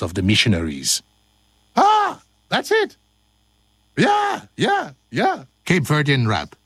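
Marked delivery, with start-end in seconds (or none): 1.8-3.0 very high falsetto cry; playful high yelp; squealed exclamation. Pretend. Goofing between takes. 4.0-5.4 high falsetto yelps; playful squeaky cheering; squealed yeahs. Pretend. Goofing between takes.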